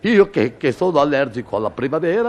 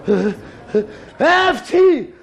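high building comic sneeze; 12 semitones higher, slower than usual. Acted. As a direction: wind up into a big fake sneeze.

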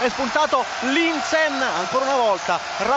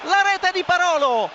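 excited goal call; 6 semitones higher, slower than usual. Real, not acted. Goal call in live commentary.

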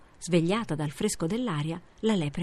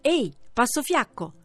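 loud, higher mocking shout; shouting hare; 5 semitones higher, 5 dB louder than usual. A narrator's reading. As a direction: yell the taunt across the path. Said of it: "le gridò".

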